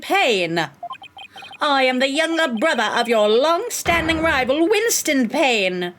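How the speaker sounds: theatrical, self-important introduction; pompous rival prosecutor. Acted. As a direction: draw out each boast grandly. Voices rival prosecutor introducing himself.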